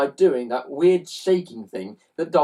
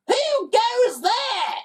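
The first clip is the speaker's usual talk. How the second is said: very high, slow, strange demanding voice; Aunt Greta demanding who is there; much slower than usual. Acted. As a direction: demand it like a haughty lady. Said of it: "demanded a voice".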